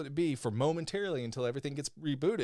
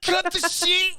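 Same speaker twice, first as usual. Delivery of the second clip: high, loud hammed-up Scottish shout; mock Scottish accent; 7 dB louder, slower than usual. Co-host mocking the host's attempt, shouted Scottish accent. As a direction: shriek it in an exaggerated Scottish accent. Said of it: "I was doing you doing the accent".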